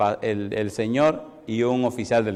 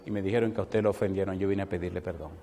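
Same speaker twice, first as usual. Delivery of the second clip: humble plea; 7 semitones lower, 8 dB quieter, faster than usual. Retold or imitated. Pastor humbly asks forgiveness.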